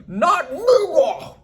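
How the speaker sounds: slow, high, drawn out through laughter; mock the yell while cracking up; laughing mock yell. Vlogger mocking Duda's outburst, laughing and drawn out.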